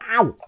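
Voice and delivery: sudden real "ow"; sharp pained yelp. Real, not acted. Cuts a finger while washing up.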